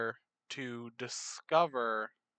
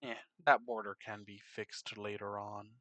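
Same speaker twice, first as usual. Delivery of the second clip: ordinary talk, nothing marked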